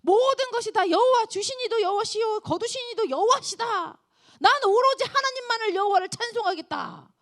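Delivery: loud, high declaration of faith; fervent confession. Acted. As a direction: proclaim it with fire. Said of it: "이렇게 얘기하는 거예요". (높고 힘찬 신앙 고백)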